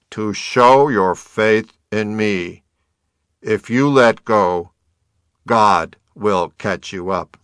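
loud, slow, solemn divine voice; grand, resounding pronouncement; booming voice of God. A narrator's reading. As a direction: proclaim it from the heavens. God's booming voice answers the hiker.

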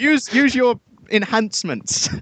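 high, slow mock battle urging. Cheering co-host on against pretend killer, high and slow. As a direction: spur him on, half joking.

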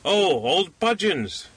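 high, drawn-out exclamation; cried out. A narrator's reading. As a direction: cry out the taunt slowly, pitched high. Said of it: "cried the little man".

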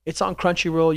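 ordinary talk, nothing marked

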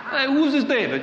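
loud, high-pitched mock New York accent. Preacher mocking Nabal for laughs, New York accent. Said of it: "this guy with a New York accent".

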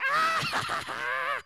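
very high, loud mock shriek of hilarity; loud mocking outburst. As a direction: burst out shrieking at a friend's mishap. Retold reaction to friend's mishap.

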